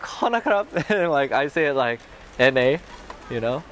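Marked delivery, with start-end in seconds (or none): 0.0-0.7 "Kona krab" with an n, the way it's heard; imitating her own version; foreigner's Thai. Shown as a demonstration. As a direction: says "Kona krab" the foreigner way, lightly raised. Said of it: "it's like".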